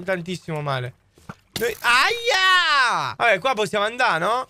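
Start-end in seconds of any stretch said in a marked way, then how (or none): 1.8-3.1 high, drawn-out "ow!"; yelp of pain. Real, not acted. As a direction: cry out as the hit lands. Gets hurt in the game. (urlo acuto di dolore)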